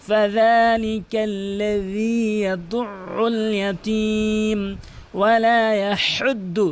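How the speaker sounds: slow tajweed chant, long held vowels; Quran recitation. Shown as a demonstration. Teacher recites the verse, slow measured chant. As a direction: recite slowly with careful throat letters.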